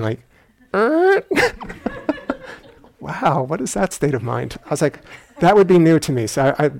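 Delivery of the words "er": sudden high creaking "Er?"; imitated creak. Teacher mimicking the monk's squeaky board, high creak. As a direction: squeeze out a rising board creak. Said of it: "playing with s-, noise making".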